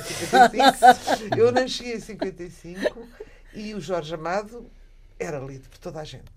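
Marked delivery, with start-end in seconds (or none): 0.0-1.4 louder, laughing through the words; laughing at own tense. Real, not acted. Laughing as she teases herself about the historic present. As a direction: joke at her own slip, laughing.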